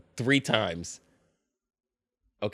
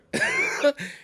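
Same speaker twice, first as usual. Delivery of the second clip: disbelieving laugh. Real, not acted.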